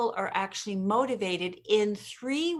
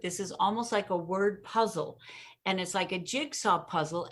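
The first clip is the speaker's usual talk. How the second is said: usual speaking voice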